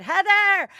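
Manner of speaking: high, loud call of a name; imitated scream. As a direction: yell it like a thrilled stranger spotting someone. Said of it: "this guy screamed at me".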